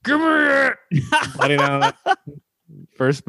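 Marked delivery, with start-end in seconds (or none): 0.0-0.7 high strangled growl through clenched teeth; mock strained growl. Patient biting his mask before the needle, mock strained growl. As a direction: act out biting down hard.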